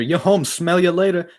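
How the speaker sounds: loud, high, slow shout; enthusiastic yell. Acted. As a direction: belt it out to the driver. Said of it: "I gotta be enthusiastic".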